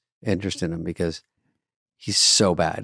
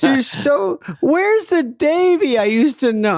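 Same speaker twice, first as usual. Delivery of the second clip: high, slow, louder lament; mother lamenting her changed son, high slow wail; wailing mom; 5 dB louder, slower than usual. Retold or imitated. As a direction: mourn the son she lost, high and slow. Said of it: "mom voice continues".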